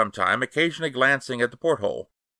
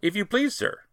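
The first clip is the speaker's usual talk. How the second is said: higher, hesitant servant's voice, a little softer; a nervous steward who stumbles over his words; faltering steward; 9 semitones higher, 4 dB quieter than usual. A narrator's reading. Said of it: "faltered Robert".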